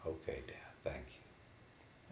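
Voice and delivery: a small, breathless whisper. Acted. Son whispering thanks to his father after the beating.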